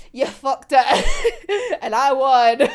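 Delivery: gloating through giggles, drawn out; laughing taunt. Acted. Voicing Dazai taunting a sore loser, laughing gloat. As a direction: crack up while playing the winner.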